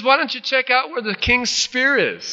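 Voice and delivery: high taunting call; playful imitated holler; mock chiding. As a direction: needle him from a hilltop, pitched high. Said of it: "he chides Abner".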